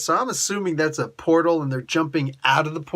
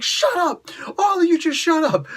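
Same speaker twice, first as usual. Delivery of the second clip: exasperated shout; 13 semitones higher than usual. Retold or imitated. Wong silencing the whole bickering team, exasperated. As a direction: slam the lid on the squabbling.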